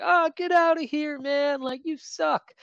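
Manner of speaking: high-pitched mock jeer; imitated crowd heckling. Cal fans to old man waving a hat, high jeering. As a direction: jeer him like an annoyed crowd.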